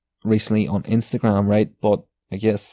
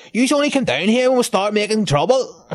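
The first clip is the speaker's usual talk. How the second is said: much higher, cheeky lad's voice; mocking wee lad; 12 semitones higher than usual. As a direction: throw it back like a mouthy local kid. Retelling a wee lad's retort.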